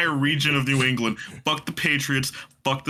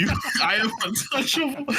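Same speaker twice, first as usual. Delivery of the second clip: laughing boast; 9 semitones higher than usual. Real, not acted.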